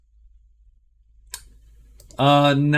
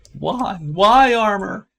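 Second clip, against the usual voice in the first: drawn-out, higher whiny why armor; mock lament; 7 semitones higher, 3 dB louder, slower than usual. Real, not acted. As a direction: wail it like a small tragedy.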